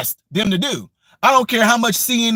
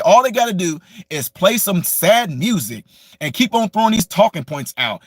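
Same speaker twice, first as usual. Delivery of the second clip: usual speaking voice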